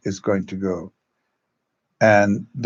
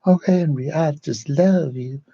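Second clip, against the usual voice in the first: put-on sweet mild girl's voice; 6 semitones higher than usual. Young actress to Henry, sweetly adoring and high. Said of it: "she had this beautiful voice".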